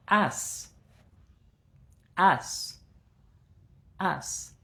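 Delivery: "as" said, repeated, fading; drilled word. Shown as a demonstration. Teacher introducing the word as. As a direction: present the word as, then repeat it. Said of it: "voy a utilizar esta palabra".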